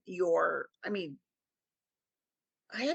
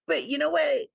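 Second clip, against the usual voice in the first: ordinary talk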